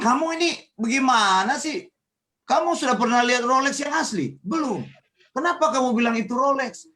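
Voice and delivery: loud, high-pitched acted telling-off; exasperated scolding. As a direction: act out berating a gullible friend. Scolds deluded friend in retold quarrel.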